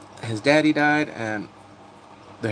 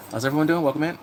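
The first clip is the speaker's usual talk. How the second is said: ordinary delivery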